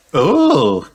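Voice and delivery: high cry of dismay. A narrator's reading. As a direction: cry out "oh" as an upset little character. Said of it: "cries Peppa".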